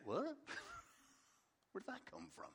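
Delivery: high, hushed mock bewilderment; baffled reaction. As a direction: play a small, high-pitched, puzzled double take. Acting out baffled Nicodemus.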